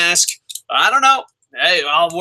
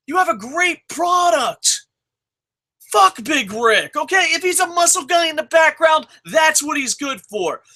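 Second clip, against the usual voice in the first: high angry rant; 10 semitones higher than usual. Real, not acted. Fan shouting advice at the promotion, high-pitched. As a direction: lecture the bookers in outrage.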